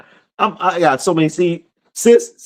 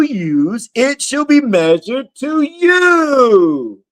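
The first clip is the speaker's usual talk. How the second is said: slow, raised, ringing sermon read; preaching scripture; 7 semitones higher, much slower than usual. Acted. Preacher proclaiming Matthew seven to his livestream, slow and ringing. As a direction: proclaim the verse to the congregation.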